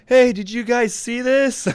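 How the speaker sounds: put-on high, slow excited kid. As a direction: call out like an eager kid. Kids running after people.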